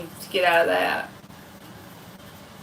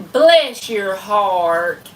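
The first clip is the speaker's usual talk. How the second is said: drawn out slowly, weight on bless; Southern drawl on bless your heart, stretched long; 4 dB louder, much slower than usual. Shown as a demonstration. Streamer drawls bless your heart for a viewer, slowly. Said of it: "Could you say".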